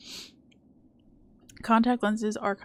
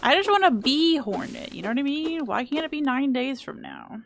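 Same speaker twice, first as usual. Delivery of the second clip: whiny longing; 5 semitones higher, 4 dB louder than usual. Real, not acted. Longing for game release.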